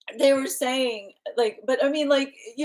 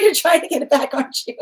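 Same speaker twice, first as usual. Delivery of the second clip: giggling, mock-sly question; laughing tease; 8 semitones higher, 5 dB louder, faster than usual. Laughing as she quotes the guide's tease. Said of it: "they're going".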